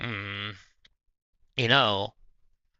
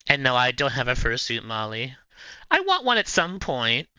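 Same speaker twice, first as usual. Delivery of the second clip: usual speaking voice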